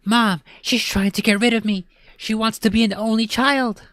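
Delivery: high, loud desperate plea; pleading kid. Acted. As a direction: beg her to listen.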